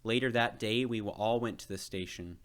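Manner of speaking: usual speaking voice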